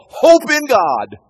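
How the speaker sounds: loud, high and slow, every word held; speaking to his soul. Acted. David telling his own soul to hope, loud and slow. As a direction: command your soul to hope. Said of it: "He says to his soul".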